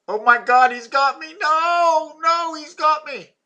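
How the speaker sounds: high, loud pretend terror; mock panic. Joking that he's got me, hammed-up panic. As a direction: cry out as if grabbed, hammed-up.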